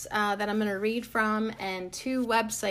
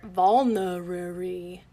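slow careful; 4 dB louder, slower than usual. Shown as a demonstration. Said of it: "how to say it right".

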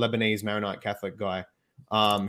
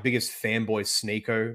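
ordinary delivery

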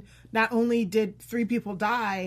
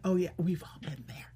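whispered aside; 3 semitones lower, 7 dB quieter than usual. Retold or imitated. Quoting the humbled sheriff.

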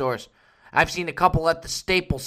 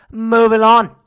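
loud announcer segue; 6 semitones higher, 13 dB louder than usual. Acted. Host returns from the ad, booming announcer shout. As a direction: shout it, much higher and louder.

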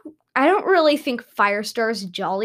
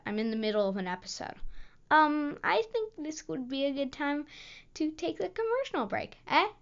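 quiet aside; 4 semitones higher, 12 dB quieter, faster than usual. Real, not acted.

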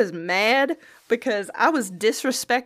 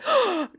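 mock gasp; 11 semitones higher than usual. Cashiers gasp at the news.

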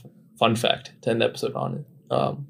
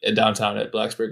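ordinary delivery

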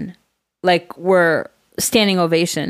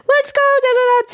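much higher sing-song cheering chant; mock cheer; 3 dB louder, faster than usual. Mom imitating a parent cheering on their kid, high sing-song.

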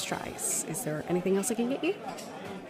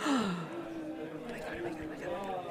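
starstruck gasp; 10 semitones higher, 13 dB quieter than usual. Acted. Recognizes her fighting idol.

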